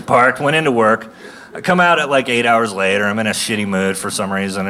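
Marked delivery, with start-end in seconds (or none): none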